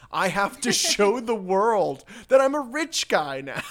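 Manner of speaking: hammy excuse, cracking up; laughing mock justification. Sam telling Kelly why he must spend money, laughing mock. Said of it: "And he's like".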